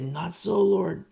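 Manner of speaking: loud, high exclaimed refusal; exclamation. Shown as a demonstration. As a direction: cry it out as an exclamation. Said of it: "Exclamation point".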